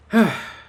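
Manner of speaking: loud, weary exhale; heavy sigh. Real, not acted. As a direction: let out a heavy sigh of frustration. Sighs after recounting his frustration.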